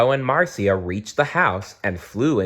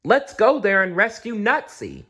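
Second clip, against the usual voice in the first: much higher excited koala voice; eager little hero voice; Blinky's voice; 12 semitones higher than usual. A narrator's reading. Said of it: "said Blinky".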